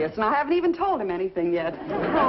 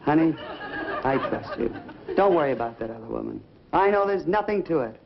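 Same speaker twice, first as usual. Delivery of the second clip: lightly lowered husband voice; imitating husband; 7 semitones lower than usual. Wife imitating her husband reassuring her, lightly lowered voice. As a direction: play the calm husband, a touch deeper. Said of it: "And he'll say".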